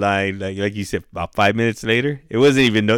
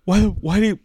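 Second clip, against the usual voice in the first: high-pitched scolding mom imitation; mock angry mom; 9 semitones higher than usual. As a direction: imitate an upset mother demanding answers, voice pitched up. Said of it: "she's fucking mad".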